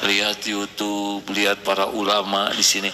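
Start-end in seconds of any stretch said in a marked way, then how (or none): none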